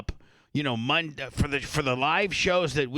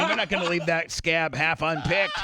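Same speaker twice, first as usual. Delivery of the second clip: talking through real laughter, higher at first; laughing; 5 semitones higher than usual. Real, not acted. Host urging the guest to tell the story, laughing. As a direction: keep going while cracking up.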